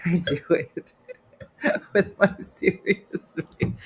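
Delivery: talking through laughter; laughing. Real, not acted. Comedian describing her serious-face routine, laughing through it. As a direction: crack up while explaining, words broken by giggles.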